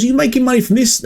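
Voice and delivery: high, fast incredulous question; mock surprised customer. Customer asking McDonald's staff about profit, mock surprised. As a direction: play the customer amazed at the cheap price.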